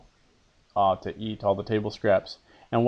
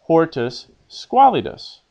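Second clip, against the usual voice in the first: "hortus squalidus" lifted higher and slowed down; quoted Latin phrase, pitched up; raised, slow quoted Latin; 5 semitones higher, 4 dB louder than usual. Shown as a demonstration.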